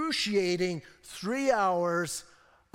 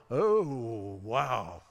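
a lightly voiced, lower, awed "oh, wow"; awed centurion; 8 semitones lower than usual. Preacher voicing the centurion at the cross, hushed awe. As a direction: let the centurion's awe drop in pitch. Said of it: "here's what he's going".

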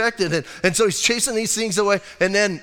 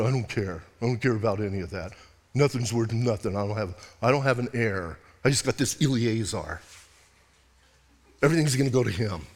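low, soft, sulky grumble; pouting Abraham; 8 semitones lower, 4 dB quieter than usual. Acted. Abraham sulking to God about having no heir, low grumble. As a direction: brush it all off like a sulking kid. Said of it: "And he's kinda pouting".

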